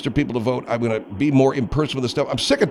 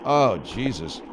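usual speaking voice

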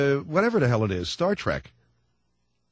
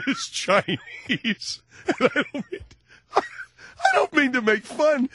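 laughing; 6 semitones higher, slower than usual. Real, not acted. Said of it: "cracking up on air".

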